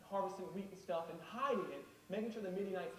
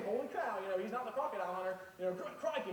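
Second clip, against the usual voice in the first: usual speaking voice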